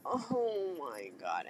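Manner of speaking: low, soft, drawn-out dismay; slow, hushed groan as an embarrassed mother; imitating mortified mom. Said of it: "my mom was like".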